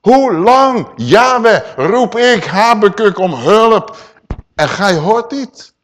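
loud, high, pleading cry to God; prophet's lament. Acted. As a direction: cry out as Habakkuk. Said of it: "Moet u luisteren wat hij zegt". (luide klagende uitroep van de profeet)

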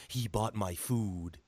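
very low, slow wrestler voice; Jesse Ventura. Retold or imitated. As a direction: drag it out deep, imitating the wrestler. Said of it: "you embody Jesse Ventura when you said that".

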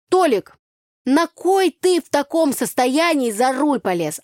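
loud, high, slow village scolding; scolding yell. Acted. Tanya yelling at drunk Tolik. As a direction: tell him off at full voice. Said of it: "И я потом говорю ему".

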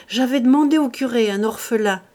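loud, high, delighted; gleeful rubbing hands. A narrator's reading. Farmer gloats to himself, loud and delighted. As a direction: relish the good news aloud.

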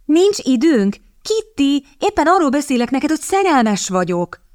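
high-pitched, incredulous comeback; indignant. A narrator's reading. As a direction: throw it back in outraged disbelief, pitched high. Indignant echo of sister's dismissal. (felháborodottan, magas hangon)

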